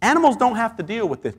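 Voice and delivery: ordinary talk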